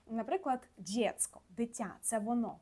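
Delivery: normal talking voice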